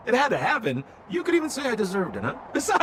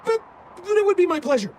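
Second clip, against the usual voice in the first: hasty fawning backpedal; 11 semitones higher, 4 dB louder than usual. Acted. Backpedals after asking for payment.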